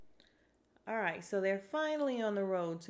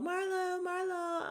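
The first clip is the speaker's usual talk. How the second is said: very high mock call, sing-song; calling out for Marlo; 12 semitones higher, slower than usual. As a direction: imitate Sheree hunting for her friend. Sheree searching for Marlo.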